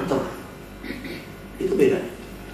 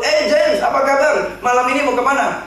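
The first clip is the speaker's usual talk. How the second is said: a bright, higher, friendly greeting; imitating a cheerful friend; 8 semitones higher than usual. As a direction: greet him like a suddenly cheerful buddy. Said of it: "Very cheerful".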